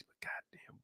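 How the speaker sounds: fed-up captain hissing his frustration; whispered curse. Acted. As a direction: whisper "but goddamn" to yourself. Captain muttering to himself.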